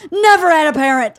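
loud, high flat refusal to yield; retold emphatic rebuke; indignant. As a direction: imitate a dad's outraged comeback. Dad insists on his rule.

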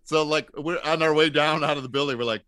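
ordinary delivery

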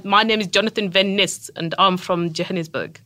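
South African accent with clipped vowels. Shown as a demonstration. Host correcting guest's attempt, clipped South African accent. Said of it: "get your vowels a little more clipped".

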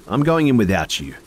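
defiant. A narrator's reading. Defiant declaration.

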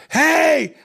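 high, loud imitated shout; panicked yell. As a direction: yell out as if threatened. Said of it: "Like he's yelling out".

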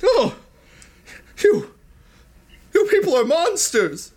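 high, halting gasped-out accusation; comic high-pitched shock; appalled Iggy. Acted. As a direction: wail in hammed-up horror with long pauses. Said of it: "He's just like".

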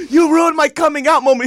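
high, loud wounded complaint; mock indignant yell. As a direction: whine loudly that the moment was ruined. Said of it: "And he's like".

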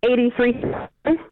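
broken-up phone line; clipped words over weak signal; choppy cut off endings. Real, not acted. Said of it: "we're losing you about every third word".

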